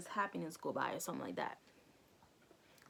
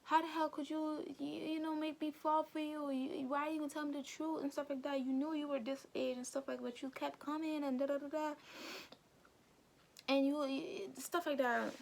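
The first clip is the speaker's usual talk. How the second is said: higher-pitched mock angry rant; mimicking angry boy; 8 semitones higher than usual. Retelling the anime boy scolding the girl, higher mock anger. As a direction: play the hurt boy yelling at her, a bit hammed. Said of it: "He's like".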